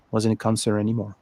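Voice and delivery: ordinary talk, nothing marked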